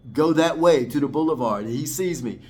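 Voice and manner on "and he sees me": ordinary talk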